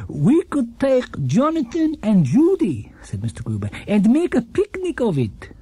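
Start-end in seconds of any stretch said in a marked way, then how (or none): 0.1-2.9 put-on higher, gentle old man's voice; kindly old shopkeeper. Gruber continues. 3.9-5.5 put-on higher, gentle old man's voice; kindly old shopkeeper. Gruber continues.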